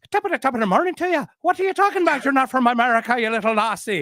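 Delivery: put-on high-pitched leprechaun Irish accent, quick at first; fake Irish. Speaker mocking the Irish fan back, in a high leprechaun Irish accent. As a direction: tease in a hammed-up high Irish voice. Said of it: "the Irish I know".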